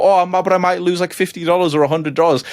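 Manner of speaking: high, whiny mock excuse. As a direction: mimic the hesitant buyer. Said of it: "mock viewer excuse".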